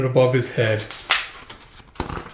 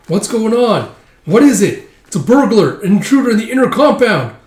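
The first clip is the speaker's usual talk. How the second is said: high, loud alarm call; alarmed guard; 8 semitones higher, 6 dB louder than usual. Acted. Guard running to warn of a burglar, high loud alarm. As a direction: raise the alarm across the compound.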